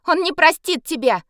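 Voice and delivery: a shout to buy time. Acted. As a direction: call it out loud and defiant. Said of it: "крикнула я".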